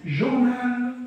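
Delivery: drawn-out "جورنال" with the last L clearly held; final L sounded. Shown as a demonstration. Teacher showing pupils the final letter, "جورنال" drawn out with L sounded. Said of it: "آه، إن،".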